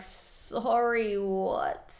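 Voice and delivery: usual speaking voice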